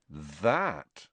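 low, dark drop. A narrator's reading. George on being asked about blackmail, darkly. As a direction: let it fall darkly. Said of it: "said George darkly".

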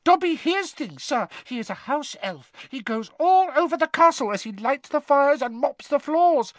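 high-pitched elf voice; earnest house elf. A narrator's reading. Dobby assuring Harry he overhears things, earnest and squeaky. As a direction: squeak it with conviction. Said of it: "said the elf earnestly".

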